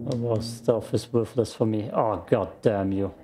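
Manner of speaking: ordinary delivery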